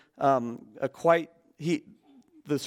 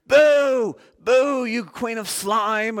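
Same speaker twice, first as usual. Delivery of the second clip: shrieking high, loud boo, then drawn-out slow taunt; jeering old crone; 8 semitones higher, 7 dB louder, much slower than usual. Retold or imitated. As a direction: cackle it at her like the mocking old hag in the crowd. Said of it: "that old lady saying".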